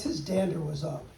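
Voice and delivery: plain reading voice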